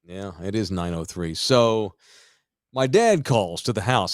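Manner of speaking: ordinary talk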